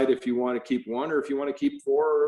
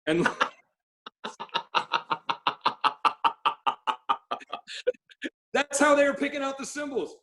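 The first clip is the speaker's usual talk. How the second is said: laughing; 13 semitones higher than usual. Real, not acted. Laughing at own story.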